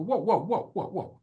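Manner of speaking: high, laughing disbelief; stunned whoa. Real, not acted. Hearing of dad's first marriage, laughing disbelief. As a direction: caught off guard, laughing.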